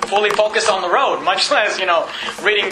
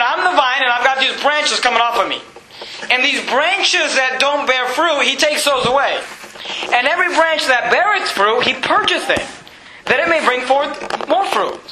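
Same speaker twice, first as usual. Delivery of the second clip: raised pitch, emphatic pulpit delivery; fervent scripture preaching; 6 semitones higher than usual. Real, not acted. Preacher expounding the vine and branches to church, fervent. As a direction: proclaim the verse to the congregation.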